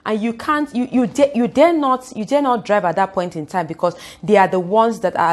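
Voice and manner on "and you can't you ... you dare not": ordinary talk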